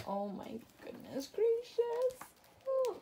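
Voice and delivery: squealing way up high over something adorable; a sudden high gush of delight. Real, not acted. Gushing over cute paper.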